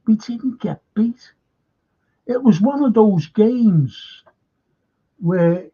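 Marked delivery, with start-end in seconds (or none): 0.1-1.3 whispered aside. Real, not acted. Grudging aside after a derby.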